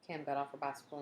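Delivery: ordinary delivery, nothing marked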